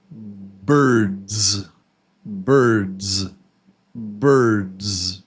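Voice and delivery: "birds" drawn out, ending on a long buzzing zz. Shown as a demonstration. Teacher drills plural ending for learners, buzzing "birds". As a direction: stretch "birds" and let the final z buzz. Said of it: "Yes, it's birds. Z, the B sound".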